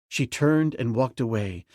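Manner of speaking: usual speaking voice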